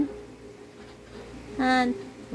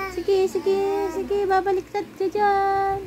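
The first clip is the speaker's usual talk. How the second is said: high-pitched warning; 8 semitones higher than usual. Real, not acted. Warns child who may topple. (mataas ang boses na nagbababala)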